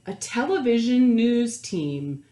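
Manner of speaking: put-on lively read-aloud voice for children; animated picture-book narrator; storybook narration. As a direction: read it like story time to a class.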